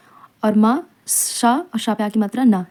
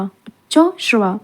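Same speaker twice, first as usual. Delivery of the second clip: Uchchaishravas slowly, split as उच्चो, श्रवा; slow, broken up; slower than usual. Shown as a demonstration. Teacher dictating a spelling word, slowly in parts. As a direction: draw Uchchaishravas out in two separate parts.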